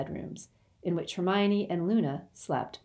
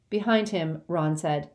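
plain reading voice

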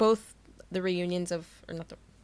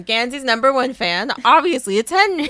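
loud, climbing higher; emphatic fan excitement; 4 semitones higher, 10 dB louder than usual. Real, not acted. Fan declares who loves Gansey most, loud excitement. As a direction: proclaim it with gleeful certainty. Enthusiastic declaration about favourite character.

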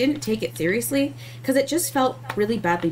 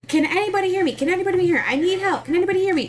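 higher-pitched, pleading call for help over the radio; mock desperate call; 7 semitones higher, faster than usual. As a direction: act out a desperate plea over the radio, voice raised. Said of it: "being like".

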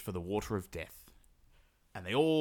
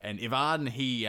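usual speaking voice

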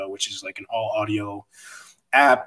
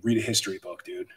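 ordinary talk, nothing marked

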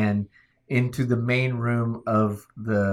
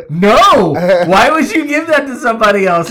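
high, loud, outraged squawk; mock indignant shout; 10 semitones higher, 12 dB louder than usual. The fire chief scolding him for the beer, loud mock outrage. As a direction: shout it like a scandalized friend. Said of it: "He goes".

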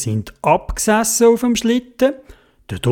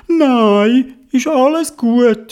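high small-character voice; little riders reassuring; 8 semitones higher, 3 dB louder, much slower than usual. Acted. Fallen riders answer Theobald's worry, high reassuring voice. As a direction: pipe it up lightly, a bit slow.

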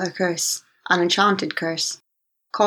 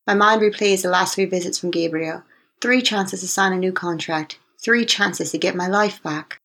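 ordinary delivery, nothing marked

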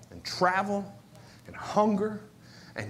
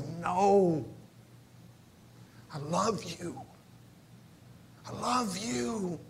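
tender soft God; 2 semitones lower, 7 dB quieter, slower than usual. Retold or imitated. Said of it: "And he goes".

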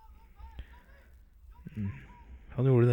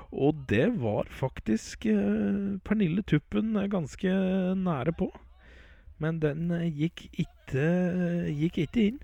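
high match commentary, slowing on the near miss; live play-by-play; 7 semitones higher, slower than usual. Real, not acted. Commentator telling listeners about a near goal, raised pitch.

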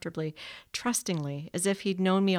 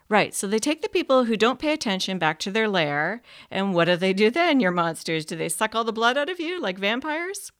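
louder, half-mocking the boy's monsters; louder teasing question; 4 semitones higher, 7 dB louder than usual. Narrator teasing the boy about his monsters, louder. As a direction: push the question with mild teasing.

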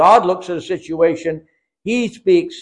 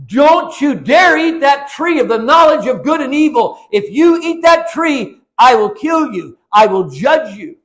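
loud, higher, threatening stern voice; stern threatening God; 6 semitones higher, 4 dB louder than usual. Retold or imitated. Preacher mocking religion's angry God, threatening and loud. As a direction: thunder it like a wrathful judge. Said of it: "Religion interprets that verse to say this".

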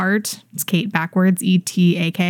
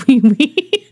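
loud, higher mimicking echo; raised-pitch teasing imitation; imitating the girl; 5 semitones higher, 6 dB louder than usual. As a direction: mimic her, amused and louder. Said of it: "She said".

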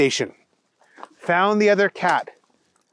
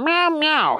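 high-pitched cat meows; meowing kitty; 12 semitones higher than usual. Acted. Thunder Kitty talking to the other cat, meowing. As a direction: meow like a chatty cat. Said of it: "said Thunder Kitty".

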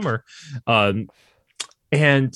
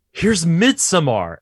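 usual speaking voice

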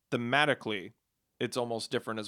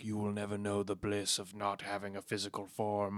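eerie otherworldly voice; 5 semitones lower, 9 dB quieter than usual. Retold or imitated. Quoting brains in a jar.

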